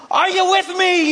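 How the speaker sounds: high mock-Scottish rallying call; rousing Scottish speech. Scottish leader to his army, rousing call. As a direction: challenge the troops in a Scottish accent. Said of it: "they're giving this great speech".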